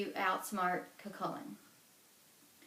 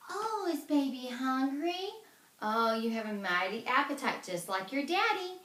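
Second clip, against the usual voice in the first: cooing mother; 5 semitones higher, 3 dB louder than usual. Acted. Una cooing to fake baby.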